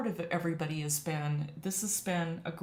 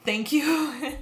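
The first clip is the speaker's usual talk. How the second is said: high, louder thank-you choked with feeling; emotional; 8 semitones higher, 6 dB louder than usual. Real, not acted. Streamer thanking viewers for kind words, emotional. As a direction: thank them while trying not to cry. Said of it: "try not to get emotional".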